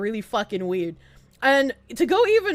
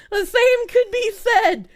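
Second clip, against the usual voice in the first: laughing; 8 semitones higher, 4 dB louder, slower than usual. Real, not acted. Repeating through laughter.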